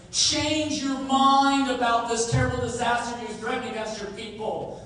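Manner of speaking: loud, high, urgent pleading to God; impassioned plea. Acted. Preacher voices Moses begging God, loud and urgent. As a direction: beg God to turn from his anger.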